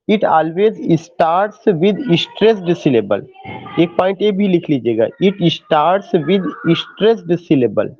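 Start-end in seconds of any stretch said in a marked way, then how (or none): 0.1-3.2 very slow, word by word, for note-taking; slow dictation. Shown as a demonstration. Teacher dictating to students taking notes, very slow. 5.2-7.9 very slow, word by word, for note-taking; slow dictation. Shown as a demonstration. Teacher dictating to students taking notes, very slow.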